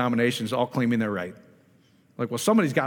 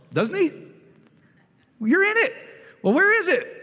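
excited. Real, not acted. Preacher building to a peak.

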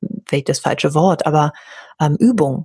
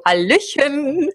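much higher, slow sing-song greeting; beaming hello; 7 semitones higher, much slower than usual. Retold or imitated. Walking into the office greeting sullen clerks, beaming and high. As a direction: beam the greeting into the room. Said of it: "so strahlend".

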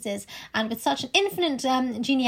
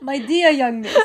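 put-on German accent; 5 dB louder than usual. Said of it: "I'm going to go German".

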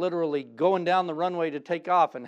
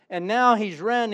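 normal talking voice

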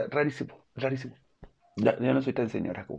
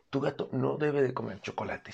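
usual speaking voice

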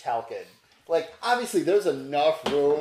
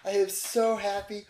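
higher, rushing relief; relieved gush; 6 semitones higher than usual. Acted. GM voicing Hope greeting the survivors. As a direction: gush it like you're thrilled they lived. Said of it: "and she's like".

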